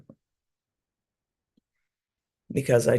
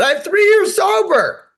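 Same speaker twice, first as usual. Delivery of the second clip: high, loud, slow burst of joy; retold elated announcement to her dad; overjoyed; 10 dB louder, slower than usual. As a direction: shout the news up high with pride. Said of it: "I was so happy".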